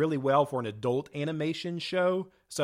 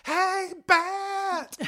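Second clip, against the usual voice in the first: very high, drawn-out cartoon squeal; overexcited cartoon kid; 4 dB louder than usual. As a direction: call out like a toddler thrilled by a pet. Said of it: "she's so excited".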